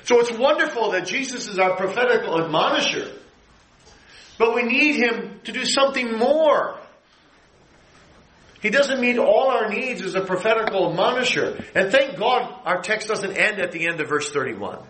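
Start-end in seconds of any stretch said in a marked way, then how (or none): 0.0-11.6 high, ringing pulpit voice; preacher in full flight; full preaching. Acted. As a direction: proclaim it to the congregation.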